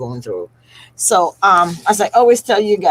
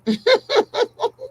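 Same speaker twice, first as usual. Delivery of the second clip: teary laugh. Real, not acted. Grieving, laughs through tears.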